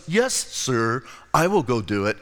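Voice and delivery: low, soft dutiful reply; mock obedient. Son promising his father he'll work, mock obedient.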